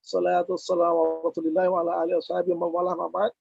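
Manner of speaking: ordinary delivery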